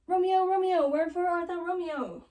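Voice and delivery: high, loud mock-theatrical calling; hammed-up balcony plea, pitched high; Juliet calling out. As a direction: call for Romeo like a hammed-up balcony heroine.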